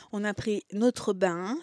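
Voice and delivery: ordinary delivery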